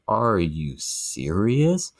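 slow, drawn-out disbelief; incredulous. Retold or imitated. Retelling her challenge to the housemate at dinner, incredulous. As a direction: stretch the question out, incredulous.

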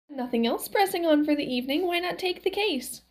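put-on high old-wife lilt; high-pitched gentle nudge; Valerie, coaxing. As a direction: suggest it casually in a high voice. Valerie coaxes Max.

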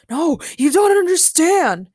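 high, loud desperate protest; crazed man. A narrator's reading. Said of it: "a crazed man".

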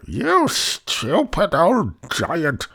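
high, loud, drawn-out scolding wife; high-pitched storybook giantess; giant's wife. A narrator's reading. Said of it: "said his wife".

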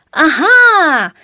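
a loud, high triumphant "啊哈"; exclaiming aha. A narrator's reading. Dodds spots the duck and shouts, loud cry. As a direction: cry out as if you've spotted it. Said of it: "多兹忍不住大叫一声".

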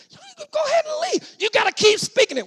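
very high squeaky retort; mock dismissal. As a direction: send them off in a high mock voice. Mock reply to the grumbler.

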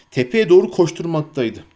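plain reading voice

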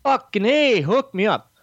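high, eager cheer that settles on "hook me up"; thrilled. Retold or imitated. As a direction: jump at the offer with glee. Retelling accepting the offer.

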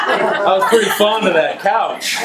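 laughing through the words, slow and high. Real, not acted. Laughing while telling a friend about the couch. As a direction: chuckle out the punchline about the couch. Laughing at his own joke.

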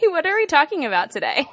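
fast, laughing question. Real, not acted. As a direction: laugh through the words. Laugh heard on line.

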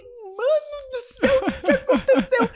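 high, drawn-out mock alarm; retold shock. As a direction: stretch it out high, half laughing in disbelief. Retells shock at sudden sobbing.

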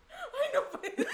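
high, loud laughing shriek of fright; mock-terrified high squeal; frightened squeal. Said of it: "me asusté".

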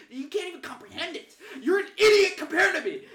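high, loud hammed-up belittling rant; arrogant asshole. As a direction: build to a mock shout of contempt. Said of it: "that's when the asshole came out".

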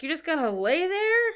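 high, loud disbelieving question; incredulous. Retold or imitated. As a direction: act like you can't believe it. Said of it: "And he's like".

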